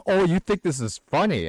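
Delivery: imitating a snippy stranger; mild mock accusation. Annoyed lady in post office line, confronting teller, mock indignant. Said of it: "And she goes".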